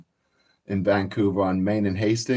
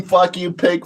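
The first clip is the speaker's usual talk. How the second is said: mock scream; 6 semitones higher than usual. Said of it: "and then I'd scream".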